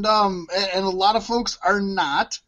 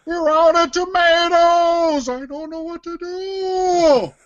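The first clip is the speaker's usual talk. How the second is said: Henchman twenty-one; 8 semitones higher than usual. Shown as a demonstration. Fast-food worker to customers, as a whining cartoon henchman. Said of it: "a bad Ray Romano accent".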